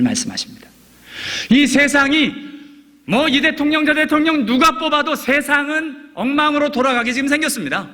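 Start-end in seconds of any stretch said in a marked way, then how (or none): 1.5-7.9 raised, ringing preacher's voice, much higher; fervent preaching. Acted. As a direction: drive the point home from the pulpit. Preaching at full force.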